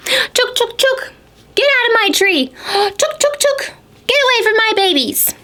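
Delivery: very high, shrill-pitched squirrel chatter; scolding squirrel. Acted. Mama Squirrel scolding the bear in a very high squeaky voice. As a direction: squeak it out like a furious mother squirrel.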